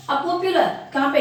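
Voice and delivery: ordinary talk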